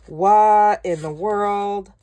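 slow, loud and stretched-out disbelief; drawn-out amazed what. Real, not acted. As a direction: gasp it out in delighted shock. Shocked at the lovely dots.

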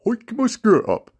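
mock high-pitched Sally voice; mocking girly voice. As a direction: camp it up as Sally. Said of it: "His favourite sayings are".

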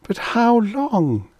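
high, slow, drawn-out lament; mournful. A narrator's reading. She asks her companion about the long wait, mournful. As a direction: plead it mournfully, stretching the words high. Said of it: "she asked mournfully".

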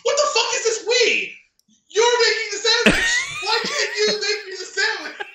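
high-pitched, exasperated mock rant; imitated snapping rant. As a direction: act out a man snapping at a fast-food clerk. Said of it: "goes on this ridiculous rant".

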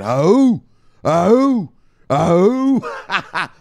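high, loud chanted oh oh oh; war chant. Retold or imitated. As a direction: chant it like a warrior army. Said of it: "They all said".

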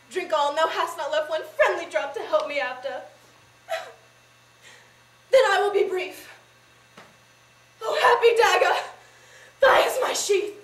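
loud, high tragic outcry; full-voiced, high-pitched stage anguish; desperate Juliet. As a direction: cry it out in theatrical despair. Juliet resolves to die.

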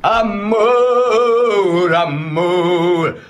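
high ringing call; shouted. Shown as a demonstration. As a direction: belt it out like a singer. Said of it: "eu vou gritar".

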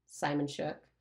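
usual speaking voice

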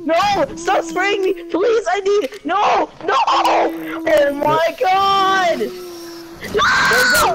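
high, wailing gamer panic; real high-pitched distressed shouting; panicked yelling. Real, not acted. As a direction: cry out in protest as you get sprayed. Panicking under fire in game.

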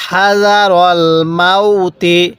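"মাউতি" ending in a sounded ti; slow recitation carrying through to the i of মাউতি; joined reading. Shown as a demonstration. Teacher showing reading without a pause, joined ending. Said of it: "এরকমভাবে শিখব".

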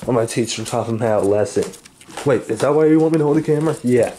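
low brooding threat, lightly voiced; gruff villain voice. Plays the angry crane character.

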